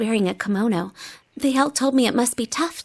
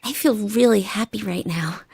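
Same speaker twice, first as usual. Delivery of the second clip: usual speaking voice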